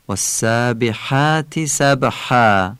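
والسابحات سبحا slowly, ح tight in the throat; Arabic ح. Shown as a demonstration. Teacher demonstrating the Arabic ح. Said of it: "هی جیمیو یه مقدار باید غلیظ و عربی بگیم، یعنی با گرفتگی صدا".

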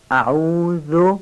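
slow, drawn-out اعوذ with a light interdental "th"; touch the tongue tip lightly to the teeth on the ذ of اعوذ; thin, delicate ذال. Shown as a demonstration. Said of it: "نازک و ظریف ذال رو تلفظ کنید". (اعوذ کشیده با ذال نازک و ظریف)

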